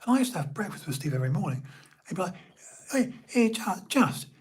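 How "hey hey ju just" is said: high, eager imitation of a friend; mimicking a high-voiced, teasing pal; Steve's high-pitched voice. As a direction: egg your mate on in a raised pitch. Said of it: "quite high-pitched in a way".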